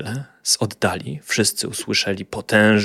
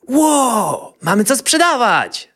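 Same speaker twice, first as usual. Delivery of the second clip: high, louder joyful cry; delighted exclamation; 4 dB louder, slower than usual. A narrator's reading. Boy delighted at the goods on the wagon. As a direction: gasp with joy at the full cart. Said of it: "zakrzyknął uradowany chłopiec".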